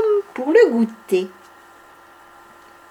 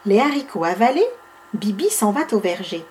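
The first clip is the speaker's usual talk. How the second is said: ordinary delivery, nothing marked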